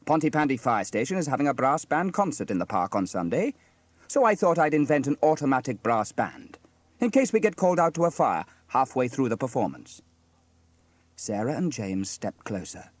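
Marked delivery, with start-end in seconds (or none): 0.1-10.0 slightly raised, louder storybook fireman; lightly voiced cheerful Sam, proud. A narrator's reading. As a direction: explain the invention with lively pride.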